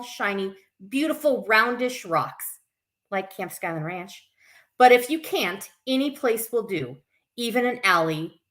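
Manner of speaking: put-on children's story reading. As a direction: read it out to little ones, bright and sing-song. Reads picture book to kids.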